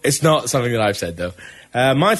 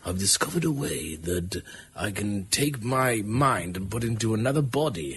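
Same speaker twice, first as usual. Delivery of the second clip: Patrick Stewart; 4 semitones lower, 10 dB quieter than usual. Retold or imitated. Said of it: "that's meant to be Patrick Stewart".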